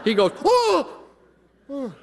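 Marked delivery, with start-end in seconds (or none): 0.4-1.0 pained cry. Retold or imitated. Kid hit in the teeth.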